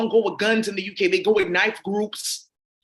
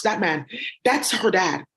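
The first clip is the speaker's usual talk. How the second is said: ordinary talk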